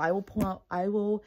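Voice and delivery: usual speaking voice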